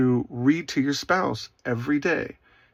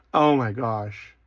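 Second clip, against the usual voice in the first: ordinary delivery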